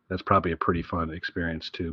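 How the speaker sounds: normal talking voice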